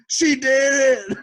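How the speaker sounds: slow, high, loud drawn-out holler; drunk yell. Retold or imitated.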